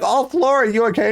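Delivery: high cheerful voice. Retold or imitated. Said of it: "they're like".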